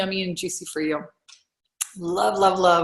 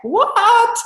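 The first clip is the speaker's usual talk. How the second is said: high, louder squeal of disbelief; stunned what; 7 dB louder than usual. Retold or imitated. Coach hearing her client was on TV, amazed squeal. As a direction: shriek "What?" like you can't believe it. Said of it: "I like".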